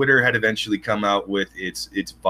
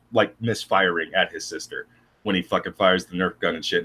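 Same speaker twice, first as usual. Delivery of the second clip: ordinary talk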